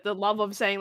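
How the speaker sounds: normal talking voice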